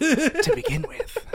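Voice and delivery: narrator voice. Retold or imitated. Finishing the quote, laughing.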